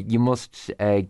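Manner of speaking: normal talking voice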